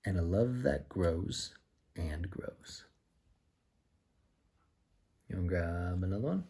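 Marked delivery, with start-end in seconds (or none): 1.9-2.8 soft whisper to close; hushed lullaby ending. Pretend. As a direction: breathe it out like a secret. Whispered end of rhyme.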